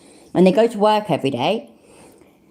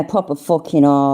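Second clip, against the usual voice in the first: ordinary talk, nothing marked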